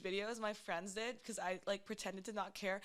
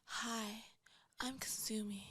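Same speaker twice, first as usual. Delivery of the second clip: breathy whispered seductive hello; sultry porn voice; 6 dB quieter than usual. Shown as a demonstration. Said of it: "this is my porn voice".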